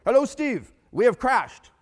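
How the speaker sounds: loud, high mock German accent. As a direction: announce it in an exaggerated German accent. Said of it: "a German accent".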